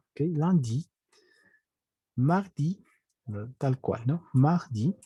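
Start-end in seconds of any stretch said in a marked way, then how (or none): none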